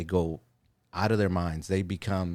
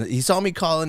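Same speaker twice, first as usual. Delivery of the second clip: higher and louder, playing it up; excited replay; 9 semitones higher, 5 dB louder than usual. Shown as a demonstration. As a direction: replay it as a thrilled moment. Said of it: "This is me playing".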